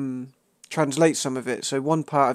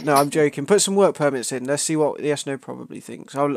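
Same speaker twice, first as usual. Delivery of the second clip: ordinary talk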